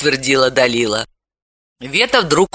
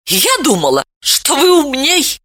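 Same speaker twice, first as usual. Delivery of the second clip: contemptuous; 10 semitones higher than usual. A narrator's reading. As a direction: toss it off with disdain. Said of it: "презрительно бросила".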